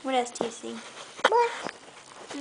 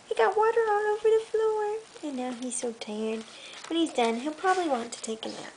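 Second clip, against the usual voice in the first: put-on reading to a child. Mother reading a bedtime book to her toddler, gentle storytelling. Reads picture book aloud.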